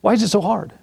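high-pitched rhetorical question; exasperated exhortation. Real, not acted. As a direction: press the congregation with a high, insistent question. Preacher repeats exasperated question.

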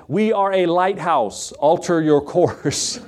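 slow, drawn-out lighthouse operator; radio reply. Retold or imitated. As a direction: imitate a deliberate radio answer. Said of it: "a crackled, uh, radio came back".

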